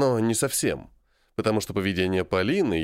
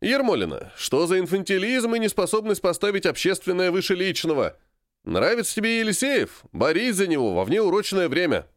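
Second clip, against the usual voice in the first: raised, scolding coach voice; barking gym coach; 8 semitones higher than usual. A narrator's reading. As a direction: scold her like a stern coach. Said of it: "рявкнул физрук".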